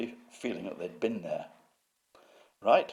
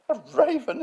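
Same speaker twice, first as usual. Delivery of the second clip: incredulous mock sneer, very high and loud; David Wilson; 10 dB louder than usual. Museum head scoffing at the raven, imitated with high disbelief. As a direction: echo it in a shrieky, scornful put-on voice. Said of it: "he is a sneerer".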